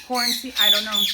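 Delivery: normal talking voice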